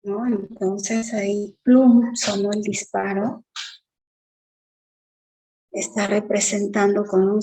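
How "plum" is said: a sudden, much louder burst on "plum" like a shot; gunshot plum. Shown as a demonstration. Teacher imitating the bang of a gunshot: loud burst. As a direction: fire the word out like a gunshot. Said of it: "con la boca está imitando el sonido".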